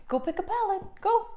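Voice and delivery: high sing-song pet talk; dog voice. Real, not acted. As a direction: coo at the dog, high and sweet. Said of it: "I do use my dog voice in this video".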